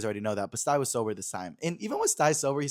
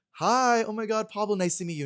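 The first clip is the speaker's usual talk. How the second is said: gushing fan greeting; 9 semitones higher, 4 dB louder than usual. Retold or imitated. Excitedly greeting a famous activist.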